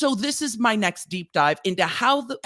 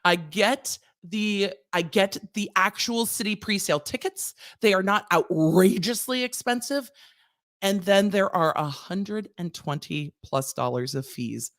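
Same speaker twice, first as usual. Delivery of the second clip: ordinary talk, nothing marked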